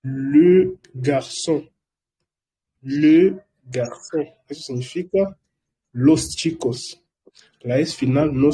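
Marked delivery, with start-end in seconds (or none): 0.0-4.2 le garçon drawn out slowly for repeating; slow French demo. Shown as a demonstration. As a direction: model le garçon slowly, twice. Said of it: "En plural sería". (le garçon muy despacio para repetir)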